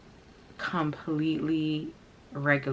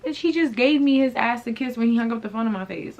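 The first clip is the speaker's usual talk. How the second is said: high-pitched, incredulous comeback; raised pitch, running indignation; indignant retort; 7 semitones higher, 4 dB louder than usual. Retold or imitated. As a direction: protest it to your friend, outraged. Indignant retort to friend's advice.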